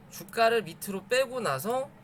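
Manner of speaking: ordinary talk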